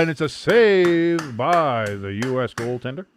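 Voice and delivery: loud, slow commentator call easing down; drawn-out soccer commentary; live play-by-play. Real, not acted. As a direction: announce the save, stretching the words.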